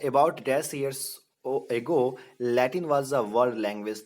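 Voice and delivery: slow, deliberate question reading; quiz reading. Shown as a demonstration. Teacher reading a quiz question slowly to the class. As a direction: spell out the question slowly for students.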